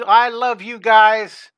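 slow, loud, stretched out declaration; gushing. Real, not acted. Teacher gushing love at the kids watching live. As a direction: pour it out to the whole class.

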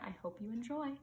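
plain reading voice

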